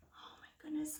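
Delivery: breathless whispered amazement; awed whisper. Real, not acted. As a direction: gasp it out in a whisper. Awed aside at huge worry.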